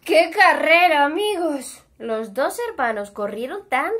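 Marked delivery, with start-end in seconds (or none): 0.0-1.8 excited exclaim. A narrator's reading. Narrator exclaims to the listeners.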